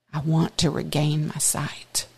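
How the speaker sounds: soft breathless whisper; whispered plea. Acted. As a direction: plead in a whisper. Blind man's plea voiced.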